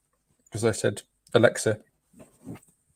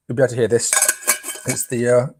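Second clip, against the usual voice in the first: usual speaking voice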